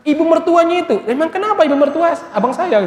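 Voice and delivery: wife's voice pitched well up, loud and indignant; the wife protesting to her husband, pitched high and indignant; high upset wife. Retold or imitated. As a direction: play the offended wife, shrill with grievance.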